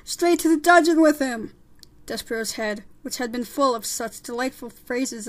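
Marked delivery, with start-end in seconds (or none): 0.0-1.5 loud, high condemning shout; crying out. Acted. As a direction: shout it as one of a mob. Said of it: "a voice cried out".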